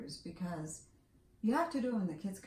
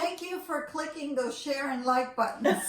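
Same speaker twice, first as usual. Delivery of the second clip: louder and higher, laughing through the words; 7 semitones higher, 7 dB louder than usual. Real, not acted. Host laughing at her own flubbed sign-off. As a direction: giggle at your own flubbed line.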